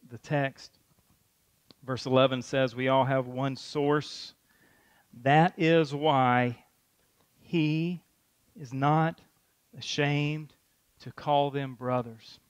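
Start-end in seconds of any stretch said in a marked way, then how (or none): 2.7-12.4 slow deliberate. Real, not acted. Slow, word-by-word reading.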